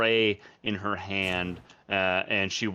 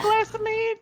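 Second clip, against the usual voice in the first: squeaky high incredulous echo; mock surprise; 3 dB louder than usual. Barmaid echoes the small mead order, squeaky and incredulous. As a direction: repeat it back in disbelief. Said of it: "Not a large glass of mead".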